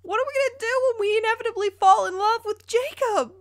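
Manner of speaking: usual speaking voice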